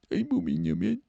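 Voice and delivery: very high, pitiful mock whimper; a little crying. Embarrassed girlfriend admits what she did, mock crying. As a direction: squeak it, embarrassed and pretend-tearful. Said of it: "She's a little crying".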